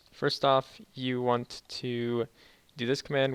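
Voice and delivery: ordinary talk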